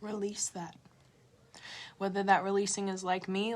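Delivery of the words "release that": breathy unvoiced whisper. Real, not acted. Urging viewers to let go, a whisper. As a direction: let it out in a hush.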